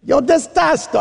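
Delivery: mock Italian. Playing at Italian.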